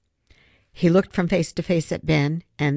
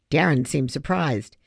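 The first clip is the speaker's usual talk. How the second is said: ordinary delivery, nothing marked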